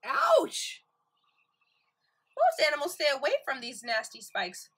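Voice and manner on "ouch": high shouted pretend ouch; mock ouch. Teacher pretending the urchin spikes hurt, high yelp.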